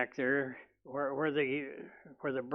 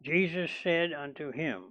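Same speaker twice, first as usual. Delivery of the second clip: ordinary talk, nothing marked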